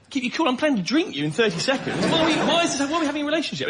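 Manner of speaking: baffled outrage at a chatty bottle; incredulous retort. Retold or imitated. Comedian to his talking smoothie bottle, incredulous and exasperated.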